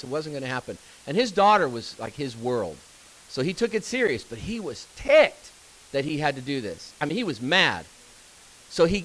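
ordinary talk, nothing marked

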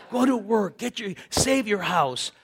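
ordinary talk

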